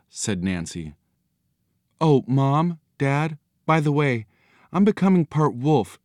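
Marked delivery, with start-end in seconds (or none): 2.0-6.0 put-on high-pitched girl's voice, casually confessing; young daughter. A narrator's reading. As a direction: pitch it up as a daughter breaking news. Girl breaking news to parents.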